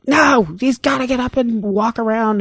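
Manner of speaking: high, insistent retold refusal; angry nurse. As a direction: imitate a nurse overruling the sister. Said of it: "stormed out of the room".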